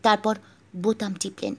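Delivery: ordinary delivery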